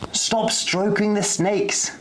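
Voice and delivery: high, slow call to the class; a teacher calling across the zoo; high and drawn-out. A narrator's reading. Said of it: "called Miss Potterhurst".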